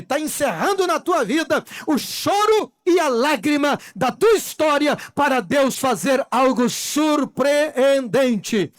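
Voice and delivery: loud, climactic pulpit cries; rousing a congregation to stop weeping; full-force preaching. Acted. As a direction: preach it out, hammering the refrain.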